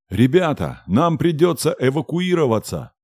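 yelling over a roaring storm; shouting. Acted. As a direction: shout at the top of your lungs. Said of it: "Ору во всю глотку".

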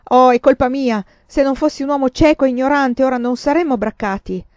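loud, bitter self-blame; self-reproach. A narrator's reading. Farmer angrily blaming himself to the lord, loud self-reproach. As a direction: berate yourself aloud.

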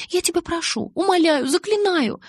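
very high, escalating begging; squeaky-high desperate pleading; imploring. A narrator's reading. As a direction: beg harder with each verb, building. Alice begs, bouncing on the spot.

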